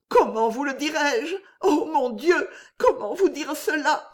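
high voice breaking with tears; struggling to say it, tearful; near tears. A narrator's reading.

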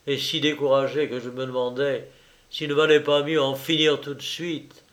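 ordinary delivery, nothing marked

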